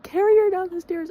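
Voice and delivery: high, loud mock command; mock order. His mom ordering him to carry the sick girlfriend, loud and high. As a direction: call it out like a bossy mom.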